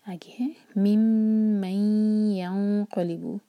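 slow chant giving every letter full time; don't rush, recite slowly; slow recitation. Shown as a demonstration. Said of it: "जल्दी जल्दी ना पढ़ें".

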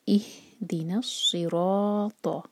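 इहदिना सिरात very slow, heavy स; recitation demo. Shown as a demonstration. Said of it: "ज़बान की टिप से नहीं पढ़ना". (इहदिना सिरात भारी स के साथ)

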